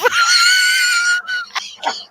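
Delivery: loud high-pitched laughing fit; long squealing laugh, totally losing it; helpless laughter. Real, not acted. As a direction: burst into uncontrollable high laughter. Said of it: "[name]'s losing it".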